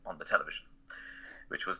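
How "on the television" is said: ordinary talk, nothing marked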